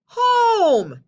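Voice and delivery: loud, high sudden shout; the idea hits him; eureka shout. Acted. Paul realising where to find food, loud high shout.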